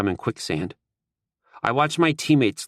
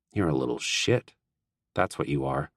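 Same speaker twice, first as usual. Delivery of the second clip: lower, softer fond insult; lightly voiced low teasing Collar; teasing grin; 6 semitones lower, 4 dB quieter than usual. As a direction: rib him affectionately.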